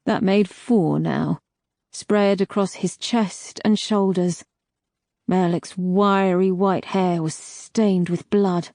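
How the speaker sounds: plain reading voice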